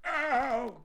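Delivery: high, loud "ow"; pained yelp. A narrator's reading. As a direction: yelp like a hurt bear. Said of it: "cried Winnie the Pooh".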